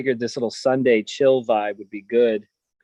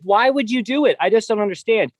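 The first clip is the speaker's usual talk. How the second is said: high, raised exasperated rant; 7 semitones higher, 4 dB louder than usual. Real, not acted. Husband venting about the campers' lights, exasperated. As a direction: vent in loud disbelief.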